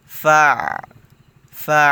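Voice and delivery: ফা said loud, with the ain squeezed from mid-throat; ফা pushed hard from the throat, the ain tight and loud; throat-pressed ain. Shown as a demonstration. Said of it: "আইনের উচ্চারণের সময় গলার মাঝখানে একটু চাপ দিতেছি".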